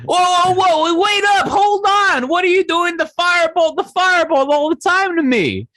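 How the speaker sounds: high, squeaky outraged skeleton voice, shouting at times; indignant skeleton. Acted. Skeleton scolding the party for killing Barry, high squeaky outrage. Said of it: "The skeleton turns to you and go".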